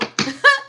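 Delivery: short high laugh bursting out; burst of laughter. Real, not acted. High laugh at a viewer saying hello. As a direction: laugh at someone popping into the live.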